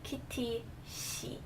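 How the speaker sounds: "Kitty she" softly and slowly, syllables apart, tense S in "she"; S sound, slowed. Shown as a demonstration. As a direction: draw out "Kitty she" to show the S. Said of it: "an S sound".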